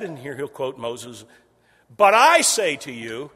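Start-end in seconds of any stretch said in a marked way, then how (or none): 1.9-3.3 loud, slow, emphatic proclamation; Jesus proclaiming. Retold or imitated. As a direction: declare it with full authority. Preacher voicing Jesus' sermon.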